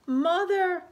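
put-on tiny, high, loud baby-bat squeak. Lost baby bat calling for her mother, high squeak. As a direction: call out like a lost little bat. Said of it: "Stella Luna squeaked".

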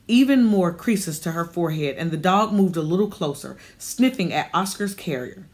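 usual speaking voice

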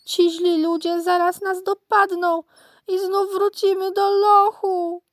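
high, loud piglet voice; acted crying of a frightened little piglet; tearful wail. A narrator's reading. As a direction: wail in panic, dragging out the end. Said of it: "zapłakało prosiątko".

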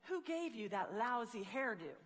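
ordinary delivery

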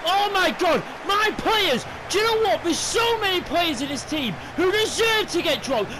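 high, loud ranting shout; furious rant. Real, not acted. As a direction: tear into the whole squad. Ranting at keeper, club, players.

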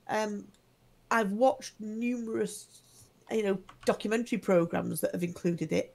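ordinary talk, nothing marked

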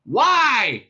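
high, loud challenge; shouting back. A narrator's reading. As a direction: yell the question back. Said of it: "shouted back Zaphod".

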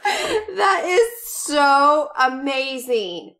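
laughing shout into a slow, teary gush; overjoyed, almost crying with delight; excited gush. Real, not acted. Gushing over a gift.

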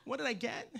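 ordinary talk

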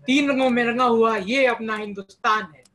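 loud, declaimed patriotic poem; patriotic verse recitation. Acted. Student host opens a poetry contest with verse, proudly declaimed. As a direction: proclaim the verse to an audience, projecting firmly.

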